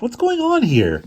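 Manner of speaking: high, slow, loud baffled question; bewildered. Acted. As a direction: demand it, totally confused. Angeline confused at the scene.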